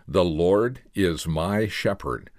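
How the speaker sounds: slow, each word set apart; one word at a time. Retold or imitated. Man comforting a dying boy, slow word by word. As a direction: spell the verse out word by word. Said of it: "one word at a time".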